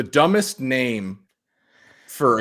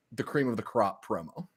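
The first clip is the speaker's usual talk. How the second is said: usual speaking voice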